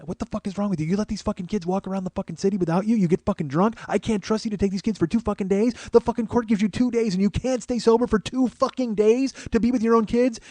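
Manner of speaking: outraged mom's rant, pitch climbing as it goes; mom's angry screaming. Retold or imitated. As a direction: imitate a mother ranting at her ex. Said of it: "she just starts screaming at my dad".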